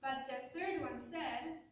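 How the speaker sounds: usual speaking voice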